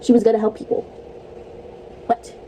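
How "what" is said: ordinary talk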